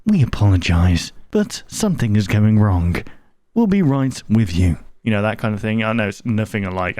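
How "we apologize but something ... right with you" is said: broadcast apology voice. Retold or imitated. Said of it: "they bring up that pop-up saying".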